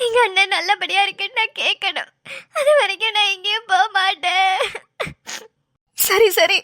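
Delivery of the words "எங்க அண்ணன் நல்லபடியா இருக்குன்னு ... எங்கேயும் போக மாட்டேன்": tearful, insisting through sobs; crying. Acted. Sister refusing to leave the hospital, crying. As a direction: cry while refusing to leave. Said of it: "அழாம இரு".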